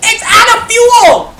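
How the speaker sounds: high loud shout; yelling. Real, not acted. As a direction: shout the warning. Yelling at teammate.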